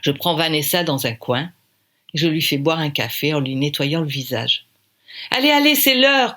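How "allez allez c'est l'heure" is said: high, loud call to hurry; loud urgent call. Acted. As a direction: hurry everyone out the door. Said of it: "crie [name]".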